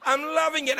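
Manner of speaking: eager repeat. Real, not acted. Repeats with enthusiasm.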